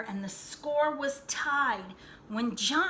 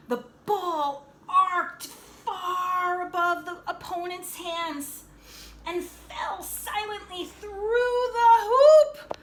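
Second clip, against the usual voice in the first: building excitement; 8 semitones higher, 9 dB louder, slower than usual. A narrator's reading. Climax of the game-winning shot.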